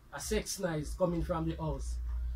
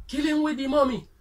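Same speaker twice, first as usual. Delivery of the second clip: put-on loud, high boyish yell; child's shout; 7 semitones higher, 7 dB louder than usual. Son bursts in on his mother, high loud yell. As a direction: shout it like a little boy playing big man. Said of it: "The son shout out".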